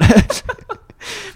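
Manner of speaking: high, loud burst of laughter; sudden high-pitched laugh; amused laugh. Real, not acted. Laughing at own failure.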